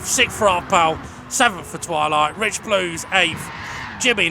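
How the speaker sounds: brisk, upbeat results call down the finishing order; live race commentary. Acted. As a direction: call the placings like a trackside commentator.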